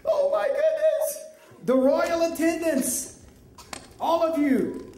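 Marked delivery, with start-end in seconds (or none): 0.0-1.2 high-pitched gasp of mock horror; acted fright. Officials seeing the scroll burn, frightened exclamation. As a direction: exclaim as if shocked, voice far up high. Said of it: "they gasp with fright".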